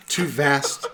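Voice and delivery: solemn recital through a laugh; poem recitation. Acted. Reciting the poem again while friends heckle. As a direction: recite it gravely, fighting a giggle.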